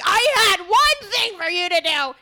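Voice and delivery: loud, high, slow reproach; exasperated scold. Retold or imitated. Host scolding cohost over missing notes, loud slow reproach. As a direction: lean into every word, fed up.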